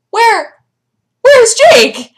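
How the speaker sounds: high, loud alarmed cry; panicked. Retold or imitated. Owner retelling her dog vanishing, high loud alarm. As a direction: call out in sudden panic.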